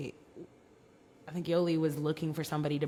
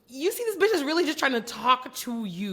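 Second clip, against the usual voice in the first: higher, louder mock outburst; pretend telling-off; 7 semitones higher, 5 dB louder than usual. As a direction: go off on her in a put-on voice. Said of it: "I would have gone off on Aussie".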